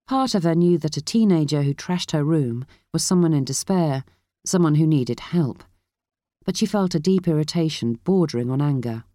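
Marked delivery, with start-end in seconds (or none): none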